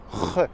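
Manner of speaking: "re" scraped from the throat; jota gutturale. Shown as a demonstration. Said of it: "avec la gorge". (« re » guttural, avec la gorge)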